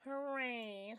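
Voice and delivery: high, loud squealed cheer; high cartoon cheer. Reading out a game story, high character cheer.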